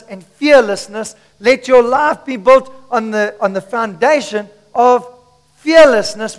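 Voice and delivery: loud, weighty sermon delivery; preacher proclaiming with force; emphatic preaching. Real, not acted. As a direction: drive each phrase home to the congregation. Preaches at full voice.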